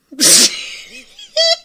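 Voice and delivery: shrieking laugh, high and loud; high burst of laughter. Real, not acted. Recalling the funniest moment, high shrieking laugh. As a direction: let a big laugh burst out.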